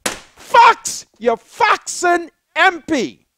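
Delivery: high, loud mocking shout; screaming at her. Shouting at the evicted woman in the office. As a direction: yell it at the woman. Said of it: "Why are you in there screaming like that?".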